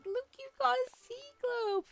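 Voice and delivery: squealing excited call. Retold or imitated. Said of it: "being like".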